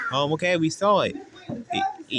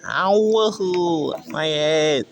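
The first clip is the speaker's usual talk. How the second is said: pained ow; 5 dB louder, much slower than usual. Acted. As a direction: yelp as if bonked on the head. Toy gets hit on head.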